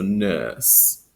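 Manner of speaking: "nurse" with a long uh, no R; British non-rhotic. Shown as a demonstration. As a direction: drop the R in "nurse". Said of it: "an extended uh sound".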